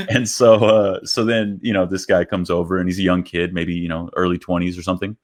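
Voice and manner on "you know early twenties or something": ordinary talk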